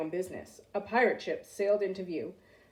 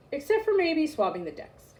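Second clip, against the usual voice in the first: usual speaking voice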